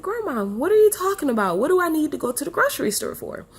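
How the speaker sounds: high, fast, baffled asking; high fast confused question to grandma. Retold or imitated. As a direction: pitch it way up in confusion. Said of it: "I was like".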